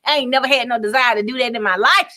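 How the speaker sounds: normal talking voice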